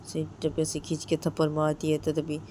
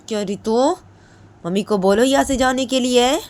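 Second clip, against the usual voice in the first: loud, high angry reply; angry shouting; 7 semitones higher, 10 dB louder than usual. Retold or imitated. Jiya yelling at her mother to leave, angry. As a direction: snap back angrily at her mother. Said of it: "तभी जिया बोलती".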